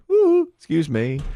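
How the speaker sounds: high, loud hoot to get attention; calling for attention. Retold or imitated. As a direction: hoot politely to catch someone busy. Getting busy register worker's attention.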